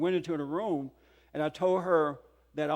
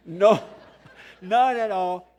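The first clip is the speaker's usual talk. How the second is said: laughing; 2 semitones higher, 8 dB louder, slower than usual. Real, not acted. Laughing about being anger-free.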